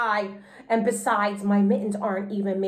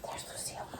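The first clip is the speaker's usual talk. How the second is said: breathy aside whisper; 23 dB quieter, faster than usual. A narrator's reading. Narrator whispering an aside to the listeners.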